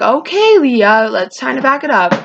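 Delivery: high, loud start imitating mom stepping in; intervening mom. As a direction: act out a mom interrupting a quarrel. Mom steps into retold quarrel.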